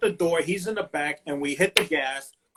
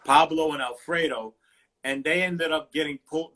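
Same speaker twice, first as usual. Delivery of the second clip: normal talking voice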